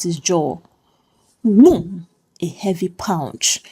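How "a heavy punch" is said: plain reading voice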